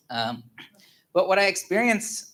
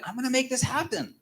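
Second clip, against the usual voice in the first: high-pitched, determined vow without extra volume; impassioned resolve; fiery determination; 10 semitones higher, 4 dB quieter than usual. Retold or imitated. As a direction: vow it with rising passion. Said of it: "this, this passion, this fire".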